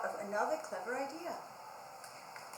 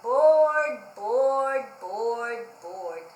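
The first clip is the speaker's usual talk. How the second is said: fading moan; 3 semitones lower, 9 dB louder, much slower than usual. Acted. Fed-up pig moaning he is bored. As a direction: moan it away to nothing. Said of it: "he moaned".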